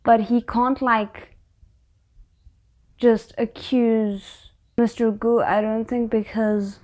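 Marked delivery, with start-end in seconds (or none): none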